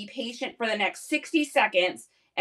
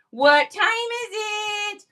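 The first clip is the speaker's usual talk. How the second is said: much higher, slow sing-song to the dog; excited baby talk; 10 semitones higher, 5 dB louder, much slower than usual. Pretend. Asking the dog about treat time, high slow baby talk. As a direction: tease the dog that treat time has come.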